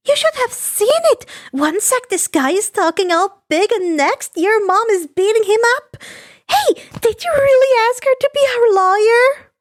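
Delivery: put-on high, bouncy girlish voice; bubbly schoolgirl on the phone; chirpy schoolgirl. Himiko chatting to the hero, high bubbly girl voice.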